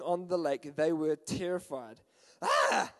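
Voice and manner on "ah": high, loud mock scream, then raised voice; frightened cry. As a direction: act out terrified men crying out. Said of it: "cried out in fear".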